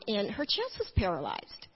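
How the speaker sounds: normal talking voice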